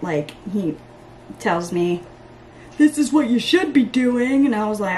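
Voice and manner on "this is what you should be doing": lecturing dad. Retold or imitated. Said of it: "I get a lecture".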